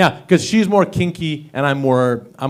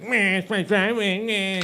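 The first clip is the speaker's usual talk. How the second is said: mock whiny neighbour; 6 semitones higher, 5 dB quieter than usual. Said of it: "he's like".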